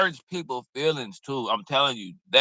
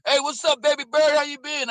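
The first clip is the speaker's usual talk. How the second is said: much higher flirty catcall voice; imitating a guy; 13 semitones higher than usual. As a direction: put on the guy hollering at her, pitched way up. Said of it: "dude say".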